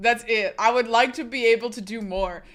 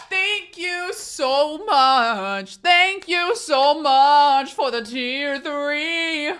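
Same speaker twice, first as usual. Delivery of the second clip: loud, very slow, higher-pitched gushing; drawn-out thanks; 4 semitones higher, 7 dB louder, much slower than usual. Pretend. Streamer thanking a tier-three subscriber, loud drawn-out gush. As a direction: stretch every word out big and loud.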